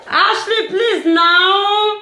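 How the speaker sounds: high calling voice across the room. Real, not acted. Vlogger calling Ashley from across the room, high call.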